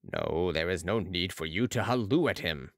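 low, calm character voice; Balsamo correcting. A narrator's reading. Balsamo corrects Marat's hallooing, low and calm. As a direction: drop the pitch and correct gently.

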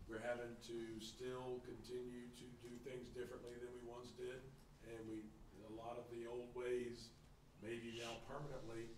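raspy whisper as the voice goes; losing voice. Real, not acted. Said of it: "Sorry, I'm losing my voice".

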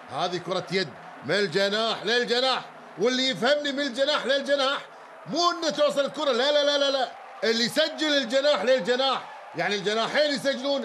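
rising, driving home من الجناح للجناح; emphatic insistence. Real, not acted. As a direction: hammer the point home. Insisting on the point.

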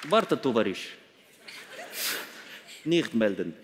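put-on low, hushed Russian-accented comrade; secretive agent with a Russian accent. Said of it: "Da gibt's auch bestimmt ein paar".

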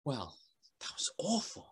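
hushed, unvoiced aside; whispered aside. A narrator's reading. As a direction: whisper it to the listener. Narrator's aside.

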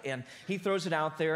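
normal talking voice

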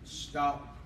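normal talking voice

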